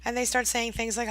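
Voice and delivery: ordinary talk, nothing marked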